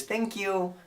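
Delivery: high, soft mock Chinese accent; soft Chinese-accented thanks; imitated Chinese owner. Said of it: "And she goes".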